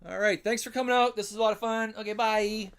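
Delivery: high, loud, fake-cheery goodbye; sarcastic chirpy farewell to viewers; mock sign-off. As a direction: pretend to end the stream brightly. Mock sign-off amid disaster.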